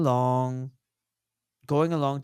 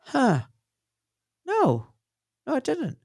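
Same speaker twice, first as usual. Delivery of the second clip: much higher, mock startled; a high beat of realization; surprised realization; 11 semitones higher than usual. Wife realizing she never had a vaccine lecture, mock surprised. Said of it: "And she goes".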